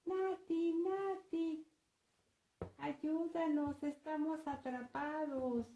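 put-on high, panicky children calling for help; anguished cries. Trapped siblings call Nati for help, anguished high cries. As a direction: start loud and alarmed, keep calling out high. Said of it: "las voces de los hermanitos muy angustiadas".

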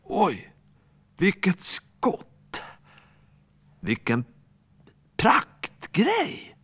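high, thrilled exclamations; amazed cheering. Acted. Teammates marvelling at Alfons's huge kick, high and amazed. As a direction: gasp in wonder at the shot.

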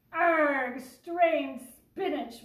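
"Arg, strange spinach" drawn out slow and loud; wailing pirates. A narrator's reading. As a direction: wail it like a pirate crew in despair. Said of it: "wailed the pirates".

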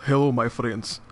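put-on Russian accent. Shown as a demonstration. Player showing off accent to co-op partner, Russian accent. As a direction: greet them as a Russian gamer, thick accent. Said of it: "like, a good Russian accent".

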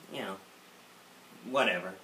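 usual speaking voice

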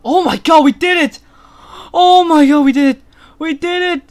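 thrilled, loud cheering; giddy relief. Real, not acted. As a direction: shout it out in joyful relief. Escaped the knight.

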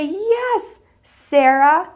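high squealed yes, then slow stretched sentence; delighted teacher praise; so excited. Acted. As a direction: celebrate a small child's success, drawing out each word. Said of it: "I'll be so excited for them".

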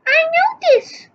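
notice said high, put-on; high character voice. Shown as a demonstration. Girl answers about the slippers, high.

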